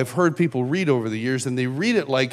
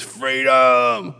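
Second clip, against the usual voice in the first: long, held mock crowd roar in the usual pitch; crowd's answering yell; 4 dB louder than usual. Everybody at the conference answers the leader, held crowd yell. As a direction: echo the cry back as a mass chant. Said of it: "everybody screams".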